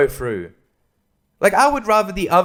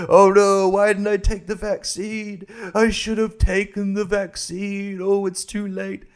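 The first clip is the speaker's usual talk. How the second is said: higher, hammed-up panic of a dying man; mock dying regret; 5 semitones higher than usual. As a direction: overact the too-late regret for comedy. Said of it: "and I'm like".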